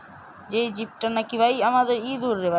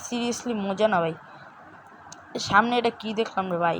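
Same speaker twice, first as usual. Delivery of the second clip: normal talking voice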